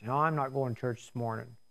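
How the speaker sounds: soft, fast, half-asleep mumble; groggy refusal. Retold or imitated. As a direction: mumble it from under the covers. Sleepy husband refusing church.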